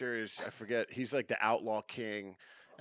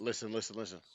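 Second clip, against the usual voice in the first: usual speaking voice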